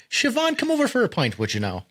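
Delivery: high-pitched playful Irish accent, dropping at the end. Shown as a demonstration. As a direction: invite them in a hammed-up Irish lilt. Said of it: "that's pretty good. You sound like".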